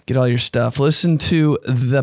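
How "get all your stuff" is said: normal talking voice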